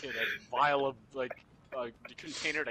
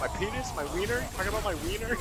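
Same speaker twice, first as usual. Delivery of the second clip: higher baffled questions; confused outburst; 6 semitones higher, faster than usual. Retold or imitated. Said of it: "unironically went".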